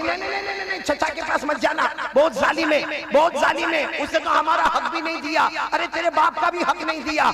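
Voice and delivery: high pleading mother's voice; widowed mother warning her son about the cruel uncle, high and pleading; frightened mother. Retold or imitated. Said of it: "तो माँ कहती".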